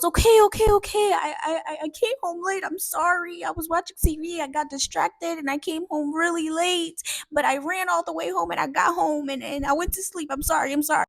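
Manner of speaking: high, pleading rush of excuses; panicked confession. Retold or imitated. Dad confessing to grandma he came home late, panicked. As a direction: imitate a scared kid apologizing frantically.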